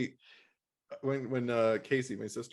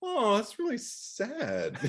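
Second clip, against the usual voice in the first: mock naive; 10 semitones higher, much slower than usual. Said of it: "you were just kinda like".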